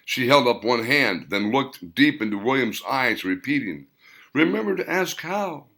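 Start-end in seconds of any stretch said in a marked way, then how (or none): 4.3-5.7 higher, lightly trembling grandmother; shaky old voice. A narrator's reading. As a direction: press the warning on him once more. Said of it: "Her shaky voice repeated".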